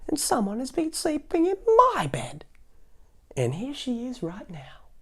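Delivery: very high, squeaky cub voice; high baby bear alarm. Acted. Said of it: "in his wee little voice".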